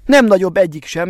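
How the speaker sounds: usual speaking voice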